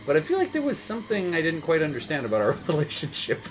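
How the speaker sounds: ordinary talk, nothing marked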